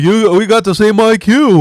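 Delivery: high, loud goofy voice; mock dumb voice. Imitating the pair of friends, high and loud. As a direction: ham up the goofy buddy.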